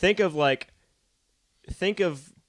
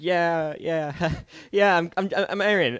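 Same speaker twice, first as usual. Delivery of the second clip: higher, stammering nervous nice guy; Aaron; 6 semitones higher, 3 dB louder, slower than usual. Retold or imitated. Meeting his friend's acquaintance, nervous high-pitched stammer. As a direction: imitate an awkward, jittery introduction. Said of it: "You seem, like, really nervous".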